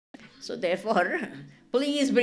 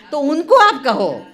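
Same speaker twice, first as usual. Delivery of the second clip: loud insistence; 4 semitones higher, 11 dB louder, faster than usual. Real, not acted.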